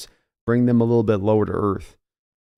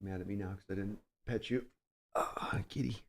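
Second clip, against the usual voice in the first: soft whisper to a cat; 3 semitones lower, 18 dB quieter than usual. Real, not acted. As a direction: whisper tenderly to the kitty. Talking to the cat.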